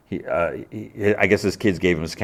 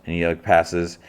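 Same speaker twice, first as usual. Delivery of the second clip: usual speaking voice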